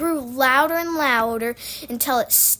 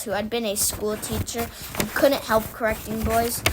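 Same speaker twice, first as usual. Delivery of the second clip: ordinary delivery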